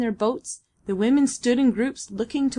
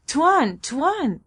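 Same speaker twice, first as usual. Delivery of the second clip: higher, urgent call with a falling end; eager call; 5 semitones higher, 4 dB louder than usual. A narrator's reading. Babalatchi running up to Almayer's veranda, calling eagerly. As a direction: call out eagerly to get his attention. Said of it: "he called eagerly".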